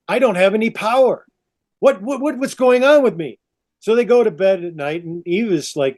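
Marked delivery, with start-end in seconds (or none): 0.1-3.4 high, stammering bewilderment; higher-pitched, baffled protest; mock panic. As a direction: play a man flustered at his own weakness. Adam panics about lost strength.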